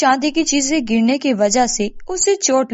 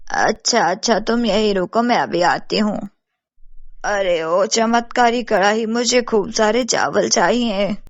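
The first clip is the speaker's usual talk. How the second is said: obliging village mother; 2 semitones lower than usual. Acted. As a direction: voice a helpful elder, pitched well down. Mother speaks to Babli, then kadhai.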